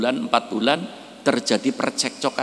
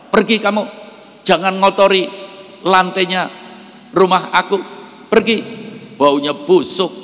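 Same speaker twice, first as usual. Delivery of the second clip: loud, higher furious shout driving someone out; angry shout; 6 semitones higher, 4 dB louder than usual. Acted. Cruel rich husband shouts the beggar off his floor, loud and furious. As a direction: bark at the beggar to get out.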